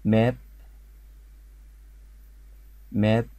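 ماب said firmly with a long open vowel; repeat-after-me model. Shown as a demonstration. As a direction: give ماب as a word to echo, lifting slightly. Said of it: "قولوها ورايا كده".

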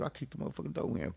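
ordinary delivery

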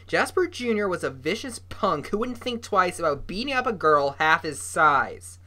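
usual speaking voice

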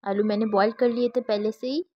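ordinary talk, nothing marked

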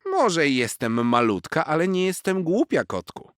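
high, loud wise little squirrel warning; a cheeky squirrel teasing a show-off kitten; squirrel Zosia. A narrator's reading. Squirrel warning the boastful cat, high and loud.

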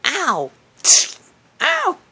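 high, hammed-up ow; pain yelps. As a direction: yelp as if jabbed. Being stabbed.

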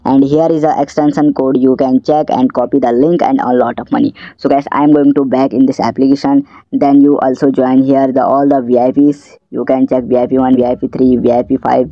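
normal talking voice

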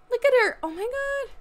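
high, loud thrilled cheer; excited cheer. Real, not acted. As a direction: cheer like a proud fan. Watching Sim skate.

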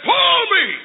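high, commanding cry falling at the end; bossy sister. Acted. Sister in wagon ordering brother, bossy. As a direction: order him sharply in a high voice.

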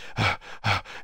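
worn-out huffing breaths; mock heavy breathing; imitated panting. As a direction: puff like a player spent on the sideline. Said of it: "he goes on the sideline, you're like".

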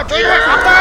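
high hammed-up cry for help; mock scream. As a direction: wail for mom, hammy.

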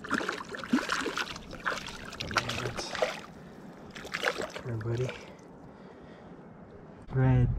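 ordinary talk, nothing marked

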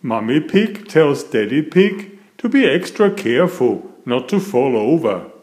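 plain reading voice

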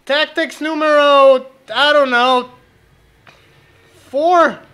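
loud exasperated. Real, not acted.

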